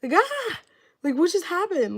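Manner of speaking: high, loud wailing cry of shock; distressed crying out. Retold or imitated. Woman waking from a ghost dream, crying out in loud high distress. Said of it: "I just started cry".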